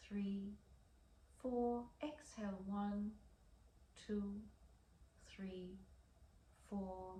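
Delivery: soft, unhurried guided breathing voice; slow breath counting. Acted. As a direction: count the breaths slowly and gently. Leads a breathing exercise.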